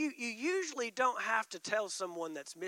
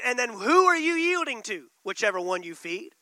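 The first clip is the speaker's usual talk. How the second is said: normal talking voice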